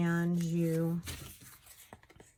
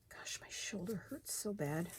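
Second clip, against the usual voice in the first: pained whisper; 13 dB quieter than usual. Real, not acted. Hurt shoulder, whispering to the camera in pain. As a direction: whisper it through real pain.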